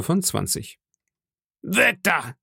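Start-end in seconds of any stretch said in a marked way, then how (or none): 1.6-2.4 higher-pitched call to back off; sharp command. Acted. Ringleader stops the knife attack, commanding. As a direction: order him away, pitch raised. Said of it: "rief Silver".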